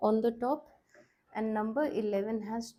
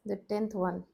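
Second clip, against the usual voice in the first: ordinary talk, nothing marked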